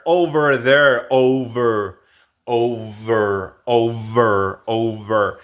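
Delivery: "over" with a buzzing v; "over" with a voiced v; English v in over. Shown as a demonstration. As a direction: drill "over" with lip on teeth. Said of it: "Otra palabra muy común es over".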